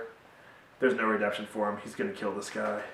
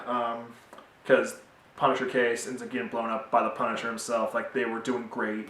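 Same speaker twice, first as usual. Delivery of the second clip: normal talking voice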